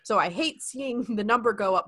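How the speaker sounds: ordinary talk, nothing marked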